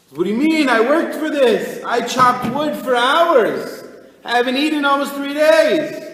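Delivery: high, loud and indignant; a worker yelling that he earned his meal; angry outburst. Retold or imitated. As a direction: shout it out, feeling cheated. Starving man's angry outburst in story.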